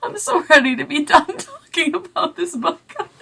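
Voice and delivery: high-pitched giggly talk; real laugh breaking into the words. Real, not acted. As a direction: talk through helpless laughter. Laughing about the book.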